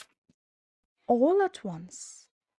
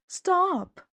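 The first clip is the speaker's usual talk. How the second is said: shout; 10 semitones higher than usual. A narrator's reading. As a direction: yell it to halt the broom. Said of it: "he shouted".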